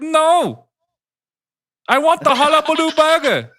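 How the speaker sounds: very high, shouted mock rant; irate prank customer. Prank customer demanding a burger, high shouting. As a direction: shout high in a put-on accent, outraged.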